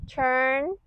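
usual speaking voice

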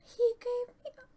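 high and loud, breaking off in amazement; stunned disbelief. Real, not acted. Player receiving a gift, high stunned squeal. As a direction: squeak it out, unable to believe it.

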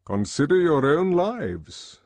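weighty, high, drawn-out address; lecturing controller. A narrator's reading. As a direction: exhort a class grandly.

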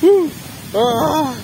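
yelps from the freezing water; in ice cold water. Real, not acted. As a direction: shriek as the icy wave hits. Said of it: "Ai, que gelo!". (gritos na água gelada)

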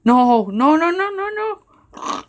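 a hammed-up falling cry; mock wail. Falling in game, protesting.

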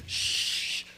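long hissed "shhh" for running water; whooshing shower noise. Retold or imitated. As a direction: imitate a quick blast of shower. Acts out a quick shower.

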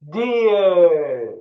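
loud, high, drawn-out "Deer"; full teaching shout, falling at the end. Shown as a demonstration. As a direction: shout the word long for pupils to echo. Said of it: "Daha yüksek sesle".

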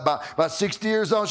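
normal talking voice

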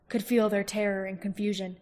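ordinary delivery

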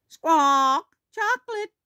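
high squawking parrot voice; Polly. Acted. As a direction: squawk loudly, then parrot the words back high. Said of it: "Polly repeats".

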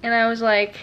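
ordinary delivery

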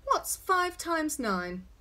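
put-on slow, high question to a small child; slow quizzing. A narrator's reading. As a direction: spell out the sum slowly and loudly. Father slowly quizzes his small child.

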